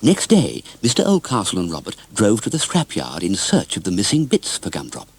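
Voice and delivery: plain reading voice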